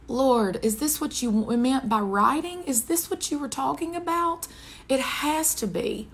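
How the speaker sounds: imploring prayer, pitched high; retold tearful plea. Woman retelling her question to the Lord, imploring. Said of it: "tears are just streaming down my face".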